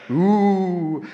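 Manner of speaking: loud jeering boo at villain; panto boo. Pretend. Pantomime crowd booing villain.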